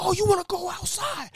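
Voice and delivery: high squeaky shouted dare; mock fight challenge. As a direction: yell the dare in a squeaky put-on voice. Cook challenges customer to fight.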